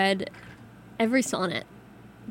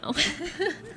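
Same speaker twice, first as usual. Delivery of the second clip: short laugh; 7 semitones higher, 4 dB quieter than usual. Real, not acted. Laughs at Dr Seuss question.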